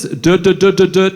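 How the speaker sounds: repeating the first sound d- over and over; mock stutter. Shown as a demonstration. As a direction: stammer on d- before delivery's. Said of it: "he's got a stutter".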